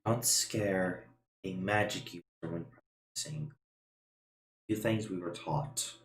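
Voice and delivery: softly hushed, a touch stern. Acted. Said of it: "he kinda says in a bit of a hushed tone".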